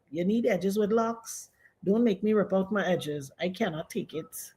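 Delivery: normal talking voice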